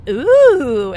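loud, high-pitched mimicked ooh; high, loud mock ooh; impressed ooh. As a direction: imitate an impressed barista's ooh. Mimics barista's impressed reaction.

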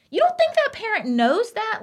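can't-believe-it challenge; incredulous. Real, not acted. Defending parents against online critics, incredulous.

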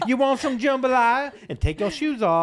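loud, high mock Southern accent. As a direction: imitate a Southern host, laughing and loud. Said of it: "like, a southern accent".

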